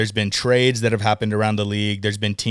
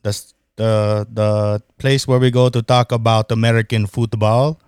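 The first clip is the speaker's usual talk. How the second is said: mock French accent; 5 dB louder than usual. Podcast host welcoming listeners in mock French accent. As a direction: greet the listeners in a jokey fake French accent. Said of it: "started as French".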